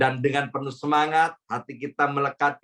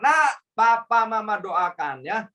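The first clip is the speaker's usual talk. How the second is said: higher, fervent parental blessing; parent's blessing; 7 semitones higher than usual. Shown as a demonstration. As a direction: bless your child earnestly, voice lifted. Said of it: "Kutuknya gimana?".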